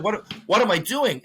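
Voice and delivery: retold bafflement; bewildered self-questioning. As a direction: question yourself, voice lifted in confusion.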